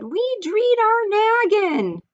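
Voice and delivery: very high, squeaky little mouse screaming; tiny excited mouse voice. Acted. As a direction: squeal it like a tiny frantic mouse calling after them. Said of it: "And then he said".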